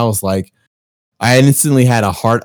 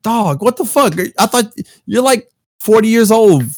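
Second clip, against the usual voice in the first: high mock outraged rant; exasperated yell; 10 semitones higher than usual. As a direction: rant at the dad in disbelief. Retold outburst at dad.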